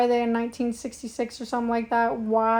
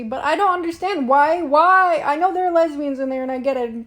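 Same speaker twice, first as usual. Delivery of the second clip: loud, higher-pitched bafflement; exasperated outburst; 3 semitones higher, 7 dB louder than usual. Real, not acted. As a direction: burst out in loud, pleading confusion. Exasperated at a confusing film.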